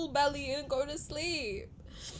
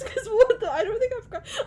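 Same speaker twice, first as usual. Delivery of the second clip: ordinary talk, nothing marked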